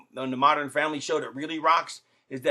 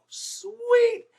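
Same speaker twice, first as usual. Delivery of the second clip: a sudden high squeal of joy; excited. Real, not acted. Wrestler thrilled to be in [name]'s video, high squeal. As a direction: shoot way up with delight.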